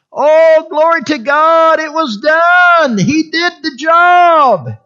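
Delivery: high, drawn-out shout of praise; exultant preaching shout. Real, not acted. Preacher praising God before the congregation, exultant shout. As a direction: cry it out slowly at full force.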